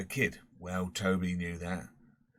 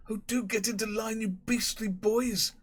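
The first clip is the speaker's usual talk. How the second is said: put-on high, slow, feeble plea to unruly boys; a helpless master wailing at the boys; a schoolmaster calls weakly from the playing fields; weak crying schoolmaster; 13 semitones higher than usual. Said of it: "heard crying weakly".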